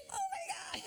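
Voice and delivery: a thrilled, high-pitched squeal; excited shriek. Retold or imitated. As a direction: squeal like a starstruck fan. Star Oprah suddenly announced.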